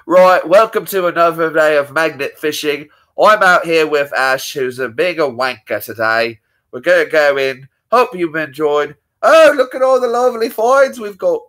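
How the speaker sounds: lively British magnet-fishing host impression; Brad. Host greeting magnet-fishing viewers, impersonated British accent. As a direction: welcome viewers as an upbeat English presenter. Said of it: "That's Brad".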